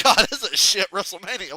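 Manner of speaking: amused disgust, chuckling mid-line; laughing. Retold or imitated. Laughing while recalling disappointment.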